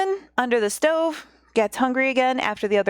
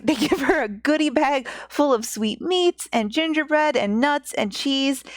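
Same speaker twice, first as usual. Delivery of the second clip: usual speaking voice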